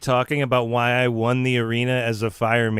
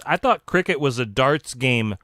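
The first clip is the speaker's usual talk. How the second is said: usual speaking voice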